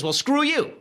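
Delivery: high, loud mock sneer; the right snapping back at the left, in a high, loud mocking voice; mocking defiant retort. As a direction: toss it off like a defiant partisan.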